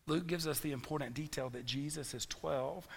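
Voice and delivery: ordinary talk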